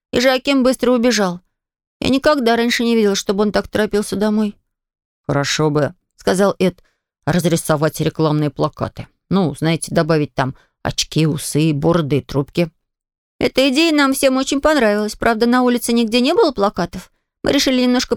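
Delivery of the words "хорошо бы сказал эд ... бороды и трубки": Ed's lower, calm voice; lower boy voice. Acted. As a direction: voice him a bit lower. (голос пониже)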